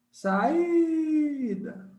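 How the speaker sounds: SAÍDA with the I high and long; held vowel. Shown as a demonstration. Teacher showing the hiatus in SAÍDA by stretching the I, held vowel. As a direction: call out SAÍDA, hanging on the I. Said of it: "Você segura no i".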